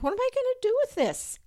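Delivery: high, fast, flustered squeal; flustered bewilderment. Retold or imitated. Mother alone with a giant box, high and flustered. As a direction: blurt it out, overwhelmed and rushing.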